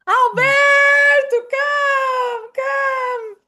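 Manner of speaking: loud, high, long-drawn call; stretched parental shout, high and sustained; calling her son. Shown as a demonstration. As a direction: holler for your child to come. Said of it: "And you call your son".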